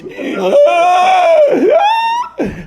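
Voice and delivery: very high, loud wheezing gasp; hammed-up airless shriek; imitated dying gasps. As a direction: mimic a man choking for air. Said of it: "So now he's dying. He's".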